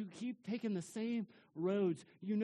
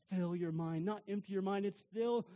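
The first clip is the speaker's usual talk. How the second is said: ordinary talk, nothing marked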